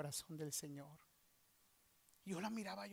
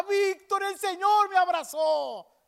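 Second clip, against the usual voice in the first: very high, loud ecstatic cry; jubilant shout; 12 semitones higher, 17 dB louder, slower than usual. Retold or imitated. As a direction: imitate someone shouting while jumping for joy. Acts friend jumping with joy. (grito de júbilo, agudo y fuerte)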